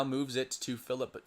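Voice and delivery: ordinary delivery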